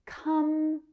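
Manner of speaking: Company split up, first syllable held high; Company broken apart to show the stressed opening; exaggerated first-syllable stress. Shown as a demonstration. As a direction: draw out the first syllable of Company, then drop lightly. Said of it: "stress is on the first syllable".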